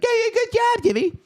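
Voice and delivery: high mock host, dismissive; Jay Leno voice. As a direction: toss off a hollow compliment. Jay's dismissive praise.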